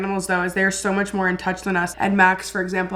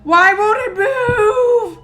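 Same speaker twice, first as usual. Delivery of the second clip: very high, loud, drawn-out cry; distressed wail; 12 semitones higher, 7 dB louder, much slower than usual. Real, not acted. Viewer upset by a frozen animal, distressed wail. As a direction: wail it slowly in alarm.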